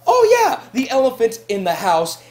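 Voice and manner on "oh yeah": high, loud burst of recognition; gasp, then exclaim it; sudden realization. Acted. Housemate remembering what to tell the camera, sudden loud realization.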